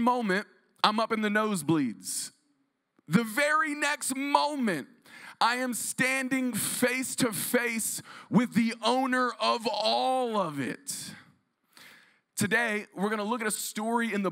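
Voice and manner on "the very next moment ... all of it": slow, higher, drawn out; slow, lifted preacher emphasis; dramatic emphasis. Acted. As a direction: land the reveal slowly. Dramatic sermon build.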